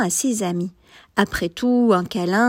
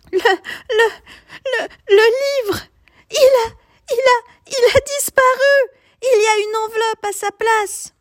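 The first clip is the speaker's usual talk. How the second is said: very high, louder stammering sob; panicked stammer; 4 dB louder than usual. A narrator's reading. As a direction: stutter in panic over the lost book.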